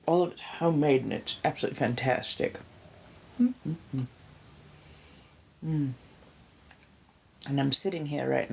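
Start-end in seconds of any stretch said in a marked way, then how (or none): 3.4-4.0 savoring hum. Real, not acted. Tasting homemade pozole.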